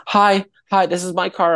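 high-pitched imitation of a car owner; mock a woman staking her claim; confronting woman. CEO telling him it's her car, mock confronting.

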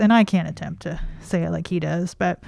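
ordinary talk

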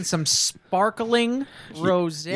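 slow, exaggerated stretch on each word; pointedly drawn-out words. Real, not acted. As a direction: linger on the drink's name with showy emphasis. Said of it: "you don't have to say it like that".

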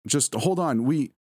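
urgent entreaty; pleading. A narrator's reading. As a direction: urge them to hang on, breaking off. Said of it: "I pleaded".

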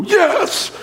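high, drawn-out yell of triumph; moviegoer's cheer. Retold or imitated. Preacher re-enacting his outburst in movies, high cheer. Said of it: "I will get up and go".

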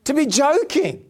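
ordinary talk, nothing marked

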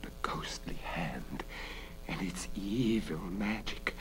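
soft, anguished realisation; hushed exclamation. A narrator's reading. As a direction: breathe it out in hushed dread. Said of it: "Bella exclaimed".

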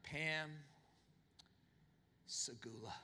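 low, soft and intimate; hushed romantic declaration; tender whisper. Pretend. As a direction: say it like a tender vow at her door. Tender declaration at her door.

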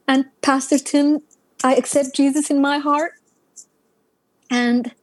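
ordinary delivery

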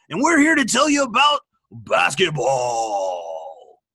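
sports announcer voice. Acted. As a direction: drop into a deep broadcast voice. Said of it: "Where's your voice?".

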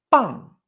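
loud "pan" with no puff on p, falling tone, ng ending; unaspirated B. Shown as a demonstration. Teacher warning what con B sounds like, unaspirated demonstration. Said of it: "pero en vez de con P, con B".

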